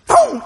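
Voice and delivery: sudden high "boom"; imitated hatchet blow. Grandpa swings the hatchet at the chicken, sudden boom. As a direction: punch out a high impact sound.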